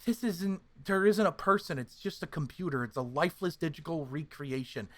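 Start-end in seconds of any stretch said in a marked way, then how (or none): none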